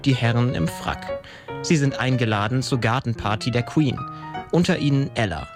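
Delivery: ordinary delivery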